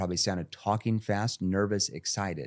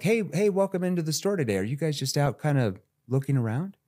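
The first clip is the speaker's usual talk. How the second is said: casual welcome; 6 semitones higher than usual. Shown as a demonstration. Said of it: "See how I'm feeding back their objection?".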